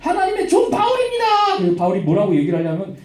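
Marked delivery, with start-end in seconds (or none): 0.0-1.6 high-pitched mock proclamation; pestering cry. Slave girl keeps following Paul announcing him, pestering cry. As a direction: sing out the announcement, high and insistent. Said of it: "따라다니면서".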